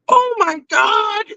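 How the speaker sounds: sudden high-pitched slow "Oh my God"; startled gasp. Retold or imitated. Streamer spotting Tim nearby, shocked high squeal. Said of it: "And I'm like".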